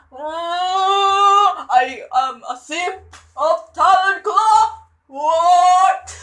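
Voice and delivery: high, loud, drawn-out confession; gushing fan wail. Real, not acted. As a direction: wail it slowly, swooning over him. Reader gushes over the character.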